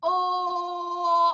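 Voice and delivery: "Or" held flat with no diphthong; Yorkshire O. Shown as a demonstration. Teacher modelling a flat Yorkshire vowel for the choir. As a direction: sustain "Or" flat and pure. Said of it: "There's no diphthong on the end".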